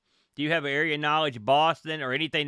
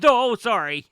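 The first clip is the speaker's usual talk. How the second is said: higher, louder startled apology; flustered sorry; 7 semitones higher, 5 dB louder than usual. Acted. GM's NPC startled as the player walks in, flustered apology. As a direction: caught off guard, blurt it out. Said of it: "And he goes".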